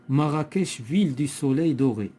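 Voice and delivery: emphatic classroom recitation; poem reading aloud. Shown as a demonstration. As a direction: declaim the line to a class. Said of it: "on va lire le poème".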